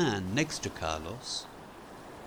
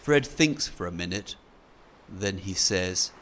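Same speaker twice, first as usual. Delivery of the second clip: plain reading voice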